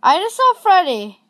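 high, loud startled cry of fright; frightened yelp. Real, not acted. Gamer spotting Freddy on camera, high frightened yelp. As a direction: blurt it out in a real jolt of fear.

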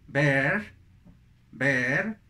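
"bear" rising on eh; contrast drill. Shown as a demonstration. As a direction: lift each "bear". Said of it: "es muy útil el contraste". ("bear" ascendente, con E)

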